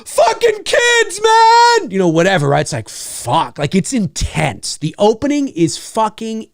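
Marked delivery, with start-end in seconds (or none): 0.0-1.9 high, loud yell from across the camp; distant hollering. Retold or imitated. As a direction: holler it out like a cop far off. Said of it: "people hollering from the distance".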